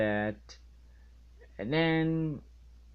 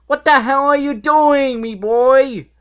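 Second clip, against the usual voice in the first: much higher, loud, shrill-pitched captain's shout; Mr. Krabs voice; 12 semitones higher, 14 dB louder than usual. Retold or imitated. Mr. Krabs yelling at SpongeBob in the mustard, loud and high. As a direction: squawk it like an outraged old sea captain. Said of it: "Mister Krabs like".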